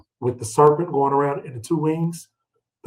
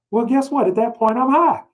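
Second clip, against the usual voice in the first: much higher, driving the point home; emphatic admission; 9 semitones higher than usual. Real, not acted. Preacher catches himself out, pitch jumping high. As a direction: land it like a gotcha. Punchline admitting getting high.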